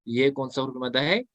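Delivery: ordinary talk, nothing marked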